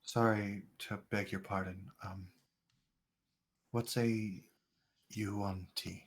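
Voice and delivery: put-on quiet, hesitant old stranger; soft blind stranger. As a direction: speak softly like a wary blind man. DM voices blind NPC softly.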